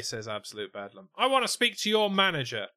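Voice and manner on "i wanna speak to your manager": higher, louder entitled Karen demand. Acted. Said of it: "The Absolute Karen podcast".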